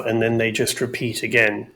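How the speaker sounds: ordinary talk, nothing marked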